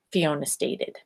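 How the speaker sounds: plain reading voice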